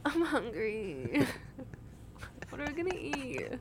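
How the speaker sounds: drawn-out, put-on whining; hammed-up pleading whine, words stretched; whiny voice. Imitating own whining at boyfriend all day, whiny. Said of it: "If my boyfriend hears the whiny voice".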